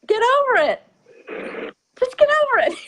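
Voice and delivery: high, loud scolding snap; mock a dismissive parent brushing off tears; harsh parent. Parent scolding a crying child, a loud high snap. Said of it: "some parents do that don't know any better and say".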